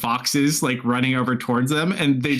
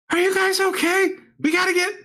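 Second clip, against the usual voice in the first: high-pitched acted call-out; yelling. Guy yelling at Beth to check the group is okay, high-pitched yell. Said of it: "like, yelling at Beth".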